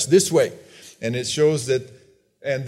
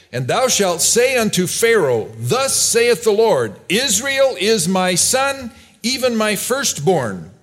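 higher, ringing decree read from scripture; scripture proclamation; 4 semitones higher than usual. Acted. Preacher reading the Lord's warning to Pharaoh, forceful scripture proclamation. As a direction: proclaim it as God's solemn command.

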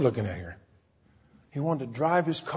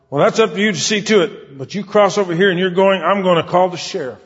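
loud, high threatening scold; retold angry warning, pitched up and loud; raging woman; 6 semitones higher, 8 dB louder than usual. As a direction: threaten him with the sheriff. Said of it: "she was raging and a puffing".

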